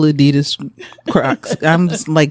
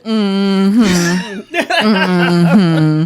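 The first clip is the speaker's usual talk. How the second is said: knowing mm-hmm. Retold or imitated. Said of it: "I was like".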